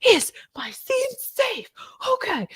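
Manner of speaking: very high, breathy, drawn-out melodrama; overdramatic. Retold or imitated. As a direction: squeak it out slowly in hammed-up alarm. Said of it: "I was always very dramatic".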